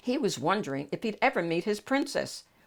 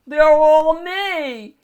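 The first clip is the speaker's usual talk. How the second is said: loud, very slow, high yodel; 10 semitones higher, 12 dB louder, much slower than usual. Acted. Shrek in the Hall of Mirrors, loud joyful yodel. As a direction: sing it out delighted. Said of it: "he yodeled".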